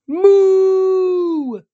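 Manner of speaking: high, loud mooing call; cow moo. Acted. Calf mooing at the stranger underwater.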